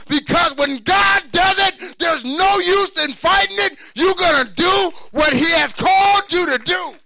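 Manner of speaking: high, shouted preaching, slowed on key lines; full-force preaching. Real, not acted. Preacher testifying to the congregation at full shout. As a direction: preach it with everything.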